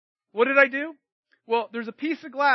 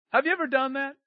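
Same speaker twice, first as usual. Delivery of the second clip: ordinary talk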